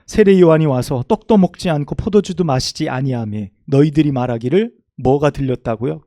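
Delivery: fervent Bible recitation; scripture reading. Acted. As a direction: proclaim the scripture to the congregation. Reads scripture aloud to congregation.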